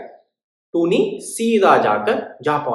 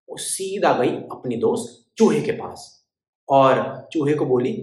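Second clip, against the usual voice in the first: ordinary delivery, nothing marked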